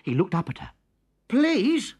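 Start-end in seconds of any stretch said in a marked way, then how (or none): 1.3-1.9 put-on higher, louder boy voice; small boy pleading. A narrator's reading. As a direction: plead like a hungry little boy. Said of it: "he said".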